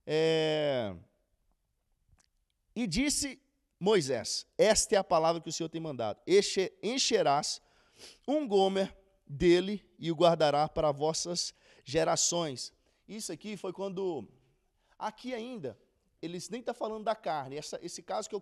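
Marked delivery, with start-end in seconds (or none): 4.6-12.8 scripture reading. Acted. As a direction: read the verses out with weight, unhurried. Reads Moses' command.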